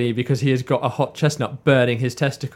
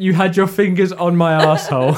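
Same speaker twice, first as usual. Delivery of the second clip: imitated delivery; 4 semitones higher, 4 dB louder than usual. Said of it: "it's just the delivery of".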